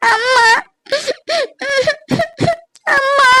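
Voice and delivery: high, tearful wail of amma; a daughter crying to her mother. Acted. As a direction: call amma through sobs. Daughter comes home crying.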